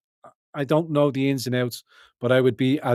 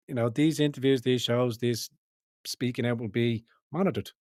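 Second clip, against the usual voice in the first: ordinary talk, nothing marked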